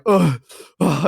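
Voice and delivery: short "ugh" groans, spaced apart; imitated disgusted groan. Man wiping his mouth in changing room, imitated disgusted groan. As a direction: mimic being grossed out after puking.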